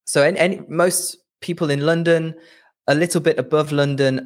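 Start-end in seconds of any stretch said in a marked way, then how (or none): none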